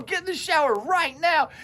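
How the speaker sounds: high urgent mom voice; bossy mom. Retold or imitated. Mom on the phone ordering him to shower, high urgent. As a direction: order the kid straight to the shower.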